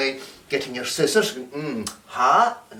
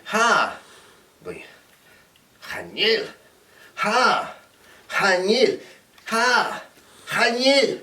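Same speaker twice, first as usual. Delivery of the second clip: rising bickering ha and chanel, climbing higher; mock argument; 6 semitones higher, slower than usual. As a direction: squabble over the puppet, getting higher. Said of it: "someone in the house who wants your puppet".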